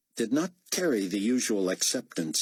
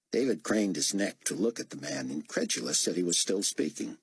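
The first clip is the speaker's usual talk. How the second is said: ordinary delivery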